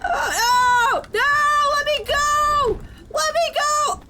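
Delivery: high, loud desperate cries; panicked yelling. Real, not acted. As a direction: scream to be let go. Being attacked, yelling.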